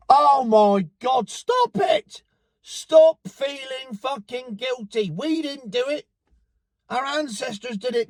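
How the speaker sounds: high, slow, exasperated outburst; exasperated rant. Real, not acted. Vlogger loses patience with guilt-ridden Britons, exasperated shouting. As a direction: plead with them to stop in frustration.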